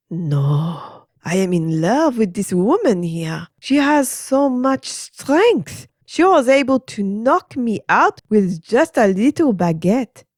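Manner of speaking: slow, high, lightly French-voiced swoon; swooning Frenchman. French teacher praising Adeline, high swooning. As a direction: sigh it out like a smitten Frenchman.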